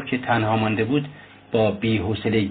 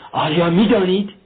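sudden sharp challenge, higher and louder; eyes blazing; 6 semitones higher, 5 dB louder than usual. A narrator's reading. Young man challenging Luzhin, blazing and loud. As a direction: fling the question out, eyes flashing.

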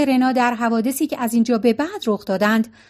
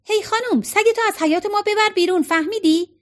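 high mock-gruff yell at the neighbour; a rough teasing shout, pitched high; girl yelling in jest at the neighbour with the dog, playful shout; joking shout; 8 semitones higher than usual. A narrator's reading. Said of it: "مگی داد زد".

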